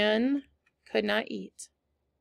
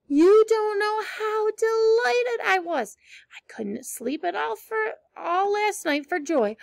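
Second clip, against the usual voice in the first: delighted gush; 8 semitones higher than usual. Acted. Anne gushing about the orphanage.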